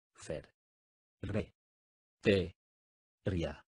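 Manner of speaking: "Fer. Re. Te. Ría." each syllable spoken apart with a pause. Shown as a demonstration. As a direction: split "Ferretería" into separate syllables. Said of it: "dila silábicamente conmigo". (separando sílabas: "Fer. Re. Te. Ría.")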